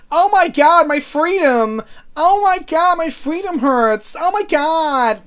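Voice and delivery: high, loud mock American accent; American impression. Streamer mocks Americans for viewers, high mock American accent. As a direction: ham up a shrieking American. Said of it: "Can I do a decent American impression?".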